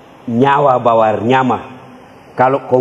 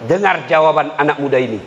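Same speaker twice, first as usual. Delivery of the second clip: ordinary delivery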